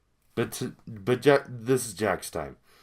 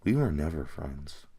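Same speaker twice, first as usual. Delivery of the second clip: Jax; 6 semitones lower than usual. Acted. Jax cutting off his friend, low dismissive delivery. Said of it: "But then he does this".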